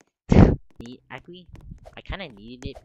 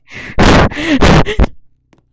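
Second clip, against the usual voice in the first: loud high laugh; 26 dB louder than usual. Real, not acted. Laughs after the fake threat.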